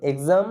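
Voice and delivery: এক্সাম said as egzam, g-z sound; demonstrated pronunciation. Shown as a demonstration. Teacher shows students এক্সাম, said egzam. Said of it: "একই রকমভাবে ই এক্স A M".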